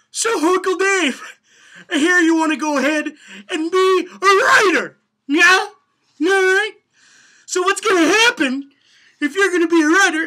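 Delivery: high, loud, straining hammed-up speaker voice; frantic motivational speaker. Shown as a demonstration. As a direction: lean in and holler at Uncle Dave. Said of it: "So what we have here is like".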